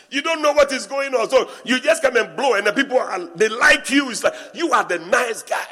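loud, high mocking dig; loud teasing at the pastor without bills; teasing jab. Real, not acted. Teasing the carefree assistant.

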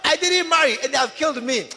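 put-on loud high plea of a murdered girl; crying out for revenge. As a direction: demand revenge from God, loud. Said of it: "she would say".

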